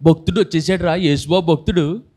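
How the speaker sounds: lightly voiced jeering classmates; a hint of schoolyard mockery; mocking schoolmates. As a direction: tease him as a mock devotee, half in jest. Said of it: "ఎన్ని మాటలు అనేవారో".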